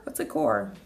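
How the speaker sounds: usual speaking voice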